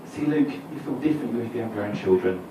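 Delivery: patronising mother. Retold or imitated. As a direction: talk down to him like a smug mother. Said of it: "that very patronising thing that mothers say".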